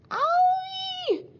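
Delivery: very high, loud howl; piercing "ow-ee" of pain; pained yell. A narrator's reading. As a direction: yelp as if struck. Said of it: "yelled Theots".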